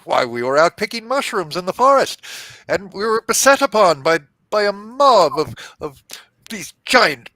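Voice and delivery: high, pleading fey voice; small fey creature. Acted. Captured fey pleads innocence to the knight, high and aggrieved.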